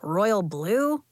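dry deadpan suggestion; understated, unimpressed question. A narrator's reading. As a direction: toss out a guess drily. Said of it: "deadpanned Elliot".

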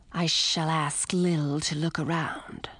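slow whispered snake voice; breathy serpent, low and hushed; hissing snake whisper. A narrator's reading. Fang the snake's voice.

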